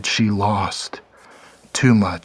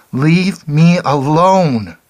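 slow, high, loud wail; drawn-out wail; 7 semitones higher, 7 dB louder, much slower than usual. A narrator's reading. The girl wailing at Clark again, slow, high and loud. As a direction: stretch the plea out in anguish. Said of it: "she wailed".

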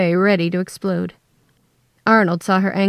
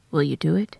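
low, soft request; voice pitched low; 4 semitones lower, 5 dB quieter than usual. A narrator's reading. Father asks investigator to find daughter, low and soft. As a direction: ask quietly, keeping the pitch down. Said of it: "his voice pitched low".

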